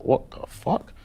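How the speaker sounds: high, breathy stunned whisper; shocked whisper. Retold or imitated. Rapper frozen in shock at the phone. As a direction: gasp it out in disbelief.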